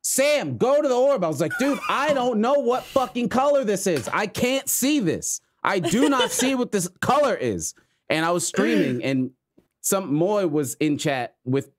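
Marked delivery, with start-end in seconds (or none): 0.0-1.2 high-pitched exasperated command; retold urgent order. Retold random player's urgent order. 1.6-7.7 high, emphatic frustrated protest; retold exasperation. Retold frustrated reply to teammate.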